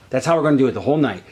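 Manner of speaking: normal talking voice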